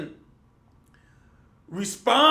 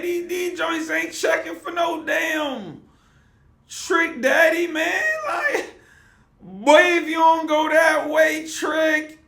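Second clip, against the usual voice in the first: real shout, higher and louder, fired up; heated shout; 8 semitones higher, 5 dB louder, slower than usual. Real, not acted. Host shouting about [name], heated shout. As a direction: yell it out in disbelief.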